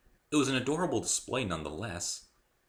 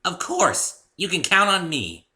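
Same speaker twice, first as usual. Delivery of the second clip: higher, louder eager pony; decisive pony; 11 semitones higher, 10 dB louder, slower than usual. A narrator's reading. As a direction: promise eagerly like a loyal pony. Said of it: "saying decisively".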